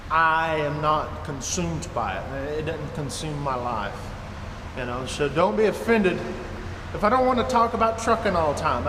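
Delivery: ordinary delivery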